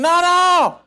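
high, pleading no, no; crying out like a frightened crowd; mock whining refusal. Israelites voiced refusing.

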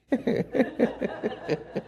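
airy laugh, high and soft; breathy embarrassed laugh. Real, not acted. Preacher laughs at his own embarrassing story, airy laugh.

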